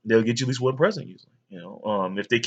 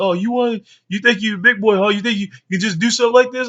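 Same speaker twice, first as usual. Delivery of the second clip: higher, louder mocking mom; mom scolding; 10 semitones higher, 5 dB louder than usual. Mom taunting her son on his birthday, higher mock scold. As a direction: taunt the kid before punishing him, pitched up. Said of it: "my mom said".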